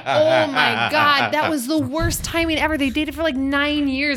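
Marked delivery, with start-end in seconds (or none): 0.1-2.8 mock horror. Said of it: "And I was like".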